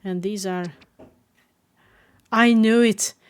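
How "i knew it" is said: loud, sudden I-knew-it outburst; triumphant shout. Acted. Umbreon realizes the truth, loud sudden shout. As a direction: blurt it out loud as it clicks.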